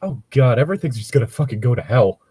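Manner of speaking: ordinary delivery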